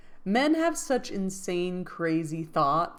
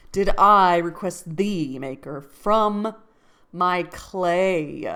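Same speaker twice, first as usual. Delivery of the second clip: thee, clay and I punched loud in iambic beat; iambic stress; 7 dB louder, slower than usual. Shown as a demonstration. Reading Milton aloud to test the meter, stresses hammered. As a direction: read it beating out the meter, louder. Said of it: "Emphasis on the dumb".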